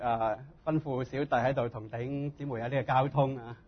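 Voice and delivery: usual speaking voice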